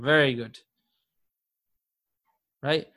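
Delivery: ordinary talk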